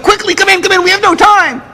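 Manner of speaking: very high, frantic nervous official; nervous urgency. Retold or imitated. Nervous official hurrying the couple in, frantic. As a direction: rush them in with a panicky high voice. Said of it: "nervous type".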